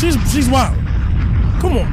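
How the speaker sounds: loud fed-up outburst; loud exclamation. Real, not acted. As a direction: shout it in disbelief. Hyped reaction to clip.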